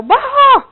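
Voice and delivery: loud, high "bah" yell; sudden comic burst of wordless yelling; mock outburst yell. Woman going crazy at a scared man, mock yell. Acting out women's scary outburst.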